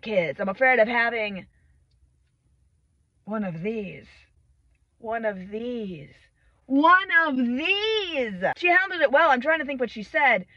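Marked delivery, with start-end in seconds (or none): 3.2-6.1 quiet, lower and drawn out; soft, slow, falling delivery; hushed mock aside. Vlogger joking about having a weird child, hushed mock dread. 6.7-8.4 mock wail, higher and very slow; exaggerated drawn-out wail. Vlogger joking about having a weird child, drawn-out mock wail.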